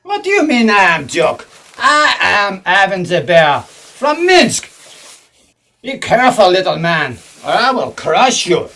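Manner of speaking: put-on hammy Russian villain accent; thick put-on Russian accent; fake Russian accent. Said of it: "You're not a Russian".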